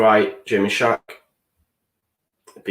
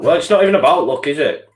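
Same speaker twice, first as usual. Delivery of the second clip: louder, higher and fast, pushing back; indignant retort; 6 semitones higher, 4 dB louder, faster than usual. Real, not acted. Fan rejecting talk of luck, quick indignant retort. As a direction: fire it back at them.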